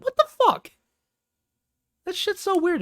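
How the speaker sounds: very high, loud what the fuck; shrill-free but high exasperated cry; incredulous outburst. Real, not acted. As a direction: burst up in disbelief. Rant outburst.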